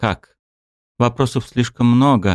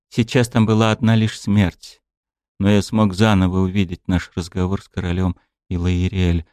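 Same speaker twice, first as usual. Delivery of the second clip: ordinary delivery, nothing marked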